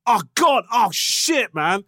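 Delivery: high, punched mock-panic outburst; retold alarm. Dentist reacting to something mid root canal, mock alarm. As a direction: imitate a panicked exclamation, easing off at the end.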